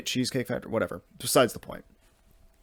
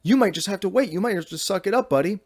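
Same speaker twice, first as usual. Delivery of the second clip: higher, pleading pushback; teen in the stall refusing, raised pitch; exasperated protest; 7 semitones higher than usual. Retold or imitated. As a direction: squeak out an indignant refusal. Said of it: "I'm like".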